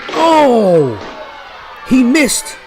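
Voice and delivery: high, loud surprised cry; surprised shout. Real, not acted. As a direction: react in shock to a miss. Wrestler misses big splash.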